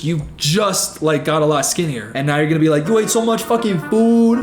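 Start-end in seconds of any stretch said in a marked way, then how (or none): 2.9-4.4 lightly hammed-up New York accent, pitched higher; a quick mocking jab in a New York accent; a light parody of a New York accent. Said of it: "with that New York accent".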